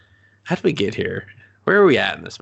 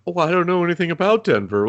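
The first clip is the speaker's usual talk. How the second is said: high, cheery clueless tourist voice; naive tourist; 8 semitones higher than usual. Retold or imitated. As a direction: sing it out like a gullible visitor. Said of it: "They're like".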